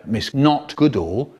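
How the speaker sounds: ordinary delivery, nothing marked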